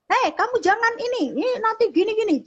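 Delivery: very high calling-out, no real volume; mock yelling. Shown as a demonstration. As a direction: imitate a parent hollering from afar. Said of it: "kita cuman teriak-teriak gitu ya".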